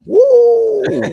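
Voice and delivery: sudden high, loud whoop; excited woo. Real, not acted. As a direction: let out a big hyped woo. Hyped after breath work.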